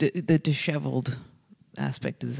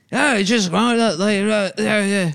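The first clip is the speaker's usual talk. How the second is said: higher, garbled mock Irish mumbling; unintelligible Irish; 5 semitones higher, 3 dB louder than usual. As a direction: slur nonsense in an Irish accent. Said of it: "it's not like he's saying words".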